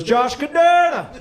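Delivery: insistent Jamaican receptionist; emphatic Jamaican accent. Retold or imitated. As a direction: repeat the name with purpose in Jamaican lilt. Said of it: "she repeated it with purpose".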